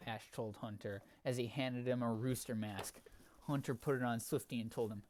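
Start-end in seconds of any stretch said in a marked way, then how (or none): none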